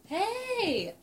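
high triumphant yelp; excited. Real, not acted. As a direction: cheer at being right. Got the answer right.

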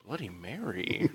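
"Bloody Mary" said low and soft, seductive hush. Shown as a demonstration. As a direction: croon "Bloody Mary" softly and low to charm her. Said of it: "can I be like".

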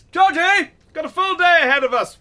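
loud, high call through the building. Acted. Boss calls his assistant to get moving, loud call. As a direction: summon him with a shout.

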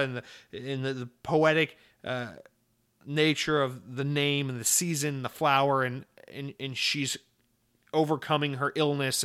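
ordinary talk

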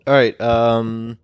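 talking through hiccups; hiccuping between words. Real, not acted. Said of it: "I got the hiccups".

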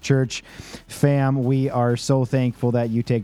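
upbeat podcast outro read; announcer. Acted. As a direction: sign off like a host. Podcast outro read over music.

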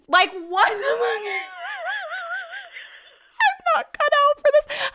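high, squeaking laugh-cry through the words; breaking into hysterical giggles; real helpless laughter. Real, not acted. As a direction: laugh till it almost turns to tears. Laughing hysterically at own mishap.

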